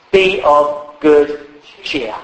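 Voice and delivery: "Be of good cheer" drawn out slowly; slow, weighted King James reading; slow solemn quote. Shown as a demonstration. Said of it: "King James puts it like this".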